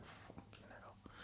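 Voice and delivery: hushed, whispered swear to himself; stunned whisper. Retold or imitated. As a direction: whisper it under your breath in dread. Retells his nervous reaction to offer.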